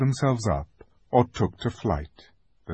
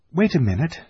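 higher, impatient little protest; cross; 7 semitones higher, faster than usual. A narrator's reading. Lucy stalls Aslan to watch her brother, cross. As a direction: put him off, mildly irritated. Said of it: "said Lucy crossly".

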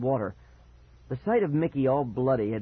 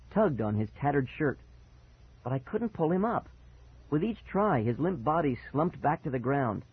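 usual speaking voice

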